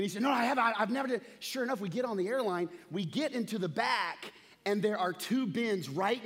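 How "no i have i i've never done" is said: higher, louder insistence; a father digging in his heels; indignant dad. Retold or imitated.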